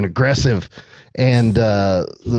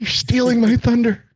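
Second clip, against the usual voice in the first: much higher, jokey indignation; mock indignant voice; 12 semitones higher than usual. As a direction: play the offended extra. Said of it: "the extra talking back".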